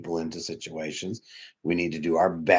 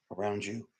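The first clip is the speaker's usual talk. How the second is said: normal talking voice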